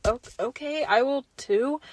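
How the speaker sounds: unsure, giving in quietly; reluctant kid agreeing under peer pressure, softly; hesitant okay. Retold or imitated. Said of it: "So I was like".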